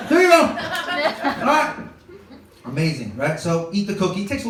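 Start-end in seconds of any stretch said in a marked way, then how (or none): 0.1-1.8 high, loud mock cheering; high excited cheer. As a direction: cheer her on from the mower, hammed up. Cheering on wife in driveway.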